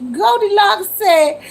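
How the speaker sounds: cracking up while reading out a comment; high-pitched, tickled laughing through the words; real laughter. Real, not acted. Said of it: "I'm laughing because of what Goldilocks said".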